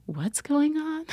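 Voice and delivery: high, slow, drawn-out question; puzzled doctor. Retold or imitated. Third doctor greeting patient on arrival, puzzled high voice. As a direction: imitate gentle bafflement, stretched and high.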